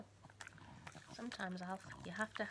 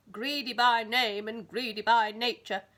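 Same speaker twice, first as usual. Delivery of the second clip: loud, slow booming voice; bellowing giant; 14 dB louder than usual. Acted. Giant scolding Mister Greedy, bellowing. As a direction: bellow it down at him. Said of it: "he bellowed".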